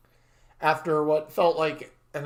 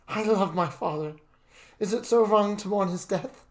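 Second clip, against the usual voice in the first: higher, louder upset pleading; tearful pleading; 6 semitones higher, 4 dB louder than usual. Acted. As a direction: ask it close to tears. Grieving son pleads.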